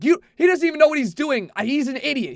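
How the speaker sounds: hammed-up huffy DJ; storming off. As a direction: imitate a DJ storming off in a huff. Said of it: "storms off".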